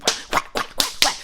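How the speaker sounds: breathy whack noises mimicking hard slaps; slap sounds. Narrator imitating the mom slapping her son, breathy whack noises. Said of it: "she was slapping the mess out this dude".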